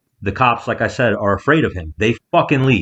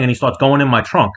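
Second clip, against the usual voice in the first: usual speaking voice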